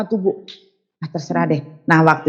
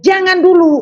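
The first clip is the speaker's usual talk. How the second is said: urgent plea; 12 semitones higher, 4 dB louder than usual. Retold or imitated. Pleading with the nurse.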